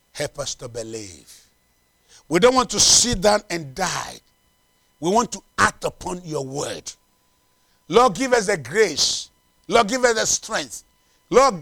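measured prayer building to fervent pleading; congregational prayer. Real, not acted. Leads a prayer.

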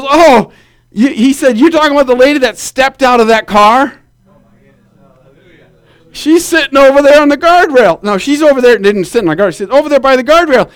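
officer points her out, lightly voiced.

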